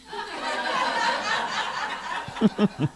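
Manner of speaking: breathy high laugh at the punchline; real laugh. Real, not acted. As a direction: let out a real laugh at your own joke. Laughs at own joke's punchline.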